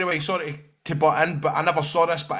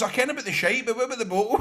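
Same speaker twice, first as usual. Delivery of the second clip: higher, incredulous retelling; mock puzzlement; 6 semitones higher, faster than usual. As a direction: ask it half-laughing in disbelief. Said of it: "I goes".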